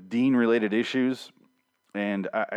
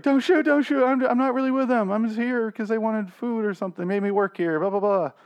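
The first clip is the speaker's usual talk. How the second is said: mock pleading in a much higher voice; panicky begging; 10 semitones higher than usual. Podcaster imitating Dean begging the fighters not to shoot, high mock panic. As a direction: squeak it out like a terrified coward. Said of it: "he's like".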